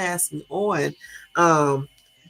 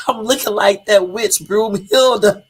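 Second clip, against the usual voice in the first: louder and slower, laughing through it; laughing imitation; 4 semitones higher, 8 dB louder, slower than usual. Retelling husband's Broomhilda joke, laughing. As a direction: quote him while cracking up. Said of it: "he would say".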